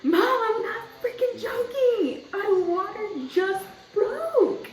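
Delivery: high, drawn-out exasperated yell; re-enacted yell. Daughter insisting to mother on the phone, re-enacted exasperated yell. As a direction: yell it slowly, insisting you are serious. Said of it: "I didn't mean to yell at her".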